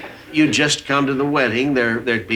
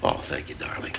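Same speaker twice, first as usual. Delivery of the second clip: whispered thanks; 11 dB quieter than usual. Real, not acted. Tender exchange between spouses.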